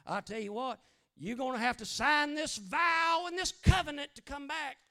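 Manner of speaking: loud, high hammed-up stern demand; mock legalistic Jesus. Preacher mocking a legalistic Jesus, loud. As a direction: bark out the terms like a legalist. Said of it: "Jesus didn't get legalistic and say".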